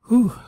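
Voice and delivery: high, sharp whew of exhaustion; winded whew. Real, not acted. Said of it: "I kinda ran out of breath".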